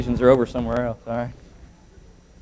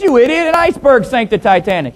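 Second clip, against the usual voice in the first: higher, riled retold retort; mock exasperation; 10 semitones higher, 6 dB louder than usual. Pilot scolding his co-pilot, mock exasperation. Acted quarrel, pilot corrects co-pilot.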